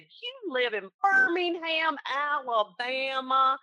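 hammed-up Southern drawl, high and very slow; thick Southern accent. Agent echoes good old boy client, hammed-up thick Southern accent. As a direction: stretch out every word in a thick Southern accent. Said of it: "thickest Southern accent".

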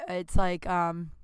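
normal talking voice

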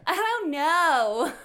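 put-on high, loud, drawn-out valley girl. Answering co-host in character, slow valley girl. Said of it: "like, a valley girl".

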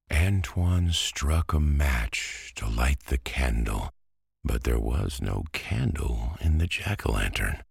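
ordinary delivery

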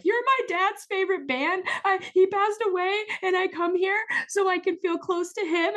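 high, tearful retold plea through sobs; sob crying. Fan tearfully telling the band member why she came. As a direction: push the words out while crying. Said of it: "sob crying all this".

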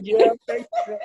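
giggling, embarrassed thanks; embarrassed laughing thanks. Retold or imitated. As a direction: laugh it off, flustered and shy. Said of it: "I was embarrassed".

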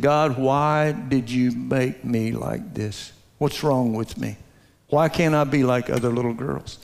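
slow, low and soft, a mild hint of tears; anguished prayer. Twelve-year-old [name] asking God why, soft slow lament. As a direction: plead quietly like a hurt child. Girl crying to God in bedroom.

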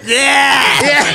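high mock cheer; shouted, laughing impression of the dad; imitated yell. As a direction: draw out a whooping yeah. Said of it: "He turned around and went".

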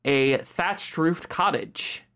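ordinary delivery

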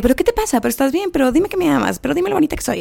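high, needy nagging voice; whiny girlfriend voice. Retold or imitated. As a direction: pitch up and plead for compliments. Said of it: "la man es como que".

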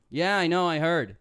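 showing how he said it rudely; said like a dick. Shown as a demonstration. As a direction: say it like a dick, bored and curt. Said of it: "I said it like".

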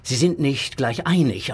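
usual speaking voice

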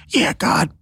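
high, put-on pretend voice; mock glib praise. Speaker voicing what he'd never say, mockingly.

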